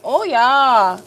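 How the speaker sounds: playful mock German accent; drawn-out "Oh, yeah" with a German accent; best German accent. Streamer savoring the satisfying job, in a mock German accent. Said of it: "In my best German accent".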